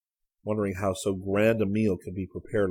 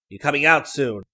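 raised voice through a door; calling out; 6 semitones higher, 8 dB louder than usual. A narrator's reading. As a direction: call in, louder and higher. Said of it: "voice called from outside the door".

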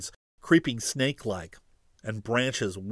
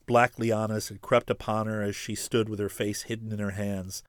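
usual speaking voice

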